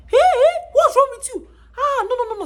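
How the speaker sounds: a startled woman jumping in alarm. Retold or imitated. Startled woman sees sobbing recruit.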